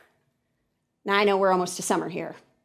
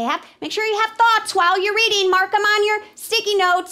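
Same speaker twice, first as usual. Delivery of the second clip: high-pitched mock instruction; nagging instructor; 10 semitones higher, 3 dB louder than usual. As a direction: parody a teacher's orders in a high voice. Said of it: "they say".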